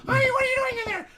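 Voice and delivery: high, loud, rushed mock wife voice; wife calling. Wife knocking on the bathroom door, high mock shriek. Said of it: "my wife, she's in there knocking".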